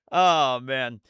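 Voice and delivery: usual speaking voice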